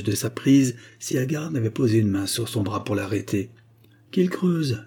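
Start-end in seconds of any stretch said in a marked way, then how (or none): 4.1-4.8 raised pitch, controlled volume; coldly. A narrator's reading. Agar letting Treadle do the digging, coldly. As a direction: dismiss him coolly. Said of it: "dit-elle froidement".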